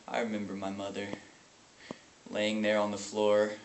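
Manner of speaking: low, hushed memory; painful recollection. Acted. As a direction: begin the memory softly and heavily. Painful memory of his mother.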